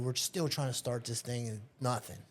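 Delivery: usual speaking voice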